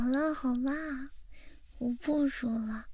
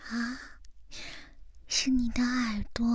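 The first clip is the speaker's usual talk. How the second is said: normal talking voice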